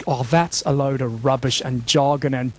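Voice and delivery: low, grumbled mock dismissal; dismissive doubter. Preacher voices doubters of ancient truth, low and dismissive.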